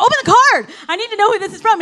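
high, loud, desperate to know; urgent shout. Retold or imitated. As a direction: yell it down the phone, frantic. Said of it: "I was like".

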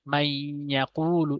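"মাই ইয়াকুলু" with মাই held in a nasal hum; ghunna recitation. Shown as a demonstration. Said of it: "বাগুন্না মানে গুণনা করে পড়া".

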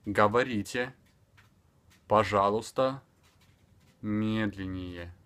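"Говорите, пожалуйста, медленнее" word by word, long pauses; spaced-out teaching delivery, last word stretched; extremely slow. Shown as a demonstration. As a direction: leave a gap after each word for repeating. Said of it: "speaking extremely slower".

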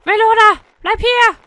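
loud, high yell; calling out. Pretend. Player ordering the melon to stay, yelling. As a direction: shout after it urgently.

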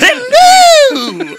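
very high, loud falsetto hello; granny falsetto. Acted. Friend shrieking hello in a granny falsetto. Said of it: "Euphigenia Doubtfire".

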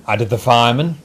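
ordinary delivery, nothing marked

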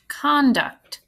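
"Conduct" clapped out in two beats; "Conduct" with each syllable distinct; split into syllables. Shown as a demonstration. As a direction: say "Conduct" as con, then duct. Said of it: "How many claps did you have?".

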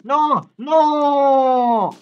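loud, high string of protests; panicked wailing. Real, not acted. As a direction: wail the no, drawing out the last. Tased in game, protesting.

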